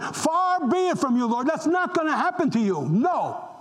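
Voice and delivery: Peter protesting. Acted. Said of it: "And Peter said".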